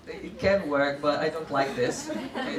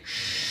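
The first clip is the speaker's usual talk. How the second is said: long hiss; 4 dB quieter than usual. Shown as a demonstration. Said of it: "And then they say".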